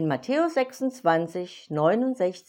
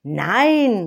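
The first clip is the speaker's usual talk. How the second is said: a frightened louder no; startled cry; 2 semitones higher, 7 dB louder than usual. Acted. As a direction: blurt it out in alarm. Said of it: "rief er erschrocken".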